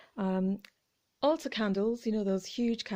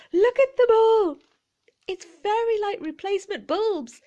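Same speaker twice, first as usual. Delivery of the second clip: loud, much higher, gushing with glee; overexcited; 4 semitones higher, 6 dB louder than usual. Real, not acted. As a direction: burst with delight at the big reveal. Thrilled reveal of a find.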